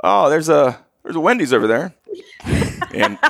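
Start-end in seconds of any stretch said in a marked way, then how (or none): 0.0-1.9 high, breezy hint; mock offhand hint about a Wendy's; fake nonchalant. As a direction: drop it casually, hoping he takes the bait. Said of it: "like, nonchalantly".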